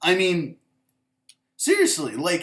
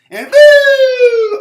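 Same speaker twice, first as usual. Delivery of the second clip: loud, high sarcastic whoop; mocking woo; 16 dB louder than usual. Real, not acted. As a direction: whoop it up, mocking the Patriots' easy ride. Mocking cheer for weak division.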